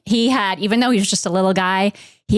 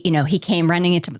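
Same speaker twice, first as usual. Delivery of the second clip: normal talking voice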